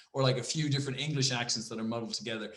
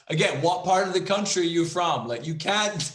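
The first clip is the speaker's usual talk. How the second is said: ordinary talk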